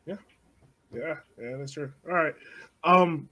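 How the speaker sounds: normal talking voice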